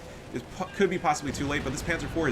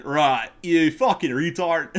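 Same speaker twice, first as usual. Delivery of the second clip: high-pitched mock Australian accent, slow and laughing; 5 semitones higher, 7 dB louder, slower than usual. Redoing the insult in a mock Australian accent. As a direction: lay on a silly Australian accent. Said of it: "Or in Australian".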